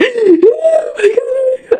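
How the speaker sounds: a hammed-up sobbing cry; mock wailing. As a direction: let out a brief imitation of a wail. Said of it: "I was wailing".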